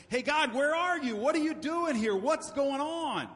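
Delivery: high, loud, demanding questions hurled upward; challenging cry. Retold or imitated. Job challenging God after his trials, loud and demanding. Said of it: "bowed up to God and said".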